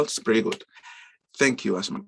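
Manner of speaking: ordinary talk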